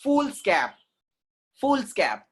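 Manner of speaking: foolscap as "FOOLS-cap", short a; correct pronunciation. Shown as a demonstration. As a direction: say foolscap crisply as fools-cap, no extra syllable. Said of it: "That's what F O O L S C A P".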